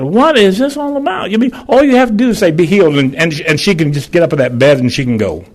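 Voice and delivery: father's high, loud protest to the Lord at his dying daughter; throwing a hissy. Retold or imitated. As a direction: cry out at God in tearful anger. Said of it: "I was crying and throwing a hissy".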